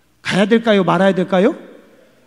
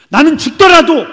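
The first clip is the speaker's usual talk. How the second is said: resolute cry; 9 semitones higher, 11 dB louder than usual. Acted. Paul's resolve voiced.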